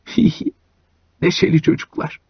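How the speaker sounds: put-on softly chuckling old driver; quiet chuckle. A narrator's reading. Iona chuckling quietly at the rowdy boys. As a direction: snicker it under the breath. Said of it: "Iona kıs kıs güldü".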